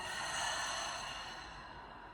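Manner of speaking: long breathy sigh out the mouth; audible exhale sigh. Shown as a demonstration. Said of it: "exhale all the way down out of the mouth".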